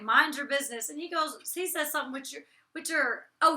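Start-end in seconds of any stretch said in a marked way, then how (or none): 0.0-0.9 snappy. Retold or imitated. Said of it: "and I was like".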